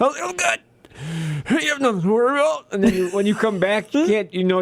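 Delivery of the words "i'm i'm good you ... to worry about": high, fast, strained I'm fine; mock choked-up I'm good; strained reassurance. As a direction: insist you're fine while choking. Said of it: "She's like".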